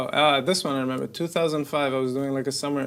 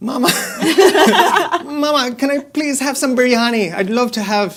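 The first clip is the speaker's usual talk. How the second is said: higher and louder, a kid in a put-on Irish lilt; mock posh Irish kid; 11 semitones higher, 11 dB louder than usual. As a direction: beg mama sweetly in a fake Irish accent. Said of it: "kids come out of school with an Irish accent".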